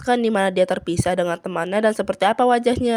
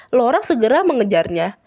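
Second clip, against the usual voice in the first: plain reading voice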